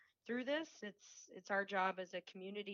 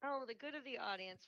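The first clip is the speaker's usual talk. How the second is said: ordinary talk, nothing marked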